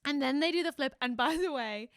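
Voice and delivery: ordinary delivery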